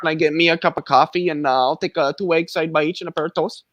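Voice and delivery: diner order in mock Canadian; folksy Canadian accent, played for laughs; hammed-up Canadian accent. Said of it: "somebody's speaking Canadian".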